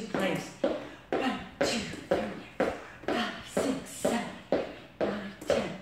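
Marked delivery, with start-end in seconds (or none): 1.1-5.7 whispered reps between breaths; winded whisper of the count; breathless counting. Real, not acted. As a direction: count under your breath while jumping. Counting reps during jumping.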